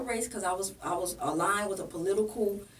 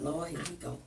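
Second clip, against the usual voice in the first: soft, low, under-the-breath groan of dread; muttered dread; 5 semitones lower, 6 dB quieter than usual. Retold or imitated. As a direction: say it quietly to yourself, already tired of him. Dreading him walking up.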